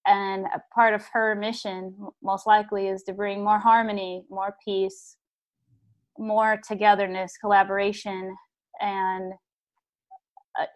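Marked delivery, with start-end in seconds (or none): none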